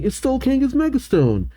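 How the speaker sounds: high, quick cry of dismay; alarmed boy. Acted. Trevor's alarmed line voiced.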